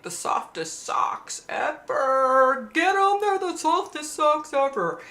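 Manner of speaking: high, slow salesy pitch; mock ad voice. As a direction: sell it like an online ad, hammed up. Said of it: "You know how it'll pop up online".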